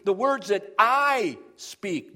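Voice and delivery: "I" held long and loud before "speak"; slow, heavy stress on "I"; emphatic I. Shown as a demonstration. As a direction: stretch "I" out with force. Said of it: "that's emphatic as well".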